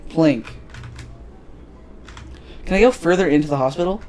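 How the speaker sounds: usual speaking voice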